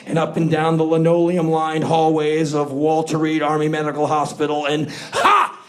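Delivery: crazed stalker fan. Pretend. Playing stalker fan to soldier.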